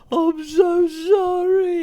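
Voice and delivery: high, slow, wailing fake sob; mock fake crying. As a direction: ham up a woman fake-crying an apology. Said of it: "she pretends that she's crying".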